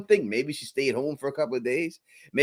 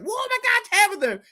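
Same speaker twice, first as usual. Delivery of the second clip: mock joyful greeting. Imagined happy friend greeting her.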